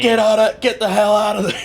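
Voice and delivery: high frantic shout; panicked yell. Pretend. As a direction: yell it at them to flee. Book shouting a warning.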